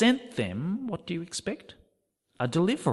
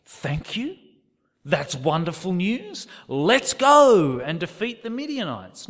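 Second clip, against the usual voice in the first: higher and louder, hammed-up enthusiasm; mock eager reply; 4 semitones higher, 7 dB louder than usual. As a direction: play Gideon as a keen volunteer, tongue in cheek. Imagined eager reply from Gideon.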